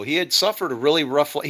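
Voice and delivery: ordinary talk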